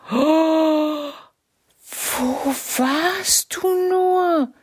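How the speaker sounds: high, loud, slow cry of shock; a startled wife calling out. A narrator's reading. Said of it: "rief sie".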